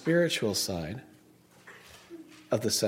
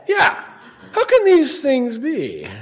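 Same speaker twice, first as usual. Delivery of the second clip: high-pitched, drawn-out mock disbelief; Nicodemus; 10 semitones higher, 6 dB louder, slower than usual. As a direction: play up the doubter, loud and high. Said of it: "What did he say?".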